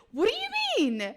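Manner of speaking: high, loud indignant question; indignant what. Real, not acted. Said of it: "Did I just question myself?".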